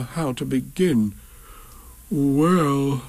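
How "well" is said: drawn-out bear voice; slow, pondering. A narrator's reading. Pooh working out right from left, slow drawl. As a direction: stretch the word out while thinking. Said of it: "he said slowly".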